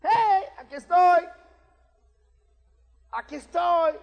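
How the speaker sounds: high, loud and slow, bursting with joy; eager Adam. Retold or imitated. Preacher voicing Adam running to God, loud and eager. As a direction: call out like a happy child running up. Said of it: "me imagino ver a Adán correr".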